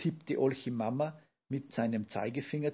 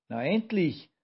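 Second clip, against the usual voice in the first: higher, louder exasperated outburst; annoyed grumble; 6 semitones higher, 5 dB louder than usual. A narrator's reading. Grandpa freed from under the bucket, annoyed grumble. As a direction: grumble it up high, put out. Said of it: "brummte Olchi Opa verärgert".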